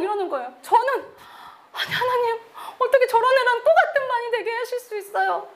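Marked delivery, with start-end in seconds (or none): 1.7-5.5 high, tearful plea with a broken laugh; crying out to God. Retold or imitated. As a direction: wail at God in disbelief. She cries out to God. (울먹이며 하나님께 호소)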